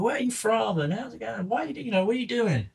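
a high, soft, chirpy small-town waitress voice; high-pitched waitress. Retold or imitated. Waitress quizzing travelers at the diner, high and soft. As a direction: play the nosy waitress, up high and light.

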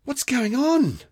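put-on high, startled girl voice; a girl caught off guard; alarmed girl. A narrator's reading. Girl asks as frost spreads, high and alarmed.

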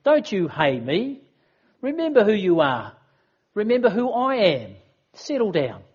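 firm master scolding his pupils; lightly voiced stern teacher; stern rebuke. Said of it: "He could have said".

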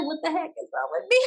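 high, laughing self-question; half-laugh of disbelief at herself. Retold or imitated. To herself after dancing, laughing in disbelief. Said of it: "And I was like".

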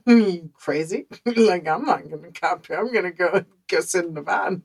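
loud, laughing mock panic; half-laughing, half-panicked, pushing back hard; a loud giggling refusal, scared of the spiders. Refusing to camp near tarantulas.

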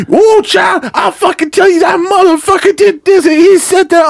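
very high, riled-up complaining voice; irate older woman. Retold or imitated. Older customer venting about the deliveryman, high and irate. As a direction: imitate an outraged older lady, high-pitched. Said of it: "And she'd be".

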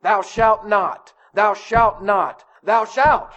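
intoned commandments. Retold or imitated.

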